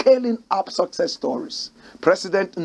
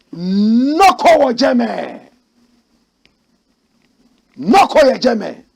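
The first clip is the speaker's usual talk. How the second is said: loud, slow, higher chant-like call; 6 semitones higher, 7 dB louder, slower than usual. Real, not acted. Host calling out to his audience, loud and drawn out. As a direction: stretch it out and call across the room.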